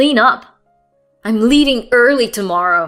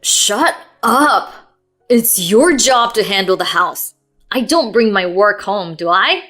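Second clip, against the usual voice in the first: loud, furious tirade; relentless anger; 8 dB louder than usual. Acted. Husband venting at wife, furious. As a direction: vent it all at her. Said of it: "he was relentless".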